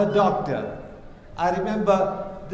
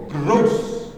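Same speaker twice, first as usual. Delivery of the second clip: mock disgust; 4 semitones lower than usual. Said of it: "with such conviction and yaqeen".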